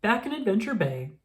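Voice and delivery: ordinary delivery, nothing marked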